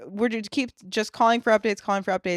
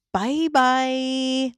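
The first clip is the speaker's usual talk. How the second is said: lightly voiced creep's drawn-out bye-bye; slightly creepy farewell, imitated; creepy goodbye; 2 semitones higher than usual. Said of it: "the creepiest bye bye".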